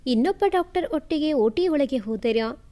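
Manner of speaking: plain reading voice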